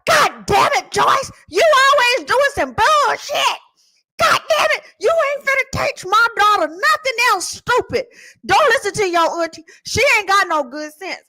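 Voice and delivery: high, shouted mock scolding; angry dad. Daddy scolding auntie over the bubble lesson, angry. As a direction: imitate a father hollering in outrage.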